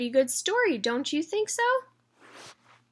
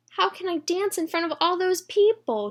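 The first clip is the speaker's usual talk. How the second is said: put-on frightened girl; 8 semitones higher, 3 dB louder than usual. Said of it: "She became frightened".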